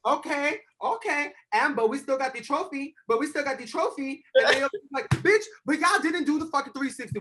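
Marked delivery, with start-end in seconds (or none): 0.1-4.2 high-pitched imitation French accent. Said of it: "his cute little, like, French accent". 5.1-7.2 high-pitched mock indignation; acted-out confrontation. Retold quarrel, Aoki hits back.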